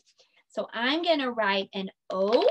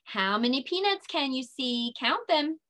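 ordinary talk

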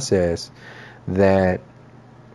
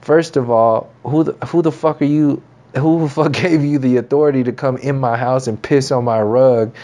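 higher and heated, tearing into the intruder; heated mock rant; 4 semitones higher than usual. Telling off the rude commenter who ruined his rug, heated higher rant. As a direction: tell off the guy who pissed on your rug, half laughing. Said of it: "I'm like".

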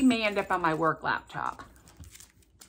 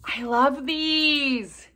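slow, drawn-out, higher gush; raised, lingering delight; 4 semitones higher, 4 dB louder, much slower than usual. Real, not acted. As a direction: stretch it out with real delight. Delighted by water drops.

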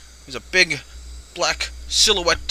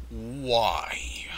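whisper; 5 semitones lower than usual. Pretend. Whispered aside while playing.

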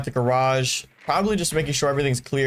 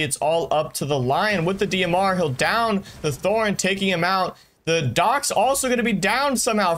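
fast, higher hyped-up commentary; excited; 4 semitones higher than usual. Real, not acted. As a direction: call the chaos with mounting excitement. Chaotic final fight in cast.

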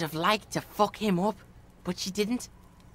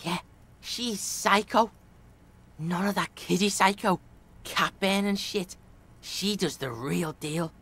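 put-on Kuno's street-kid voice; 5 semitones lower than usual.

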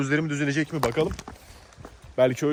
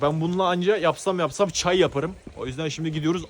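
ordinary talk

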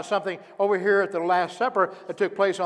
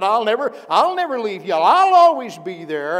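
loud vow; 5 semitones higher, 9 dB louder than usual. Acted. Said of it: "and Peter said".

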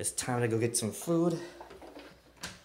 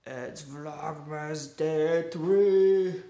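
sing-song intro; 6 semitones higher, 8 dB louder, much slower than usual. Acted.

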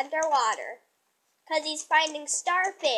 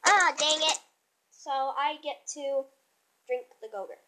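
ordinary delivery

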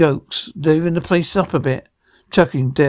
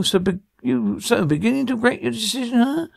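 higher put-on villain voice; taunting villain; 6 semitones higher than usual. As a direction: taunt your captive, pitch climbing to the end. Villain taunts the trapped child.

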